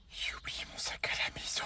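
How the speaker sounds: tiny voiceless whisper; whispered confession. Acted. Child afraid to tell the angry parent, whispering. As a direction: whisper it, not daring to be heard.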